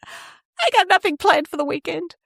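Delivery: high, gleeful announcement; bursting with good news; excited. Retold or imitated. Telling her husband about a free weekend, excited. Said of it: "I was saying to my husband".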